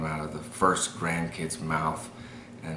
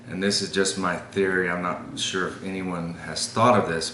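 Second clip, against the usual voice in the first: ordinary delivery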